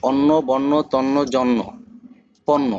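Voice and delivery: অন্ন, বন্য, জন্য each with a long double n, "onno, bonno, jonno"; teacher's word list, পণ্য said "ponno" with the n doubled; doubled final consonant. Shown as a demonstration. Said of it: "তাহলে দুইবার উচ্চারণ করাবে". (অন্ন, বন্য, জন্য শব্দে ন দুইবার উচ্চারণ)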